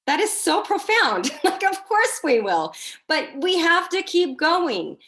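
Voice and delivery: high, loud, gushing realization; hammed-up amazement. Speaker replaying her reaction to a leader's motto, amazed. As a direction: exclaim it way up high, with a laughing edge.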